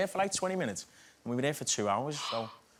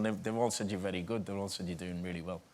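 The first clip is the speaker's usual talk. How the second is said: lower, softer voice, a hint of a tough older man; imitating Joe Pesci; 6 semitones lower, 6 dB quieter, faster than usual. Actor retells Joe Pesci inviting him to sit, lower and softer. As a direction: drop the voice low and quiet like a mob boss.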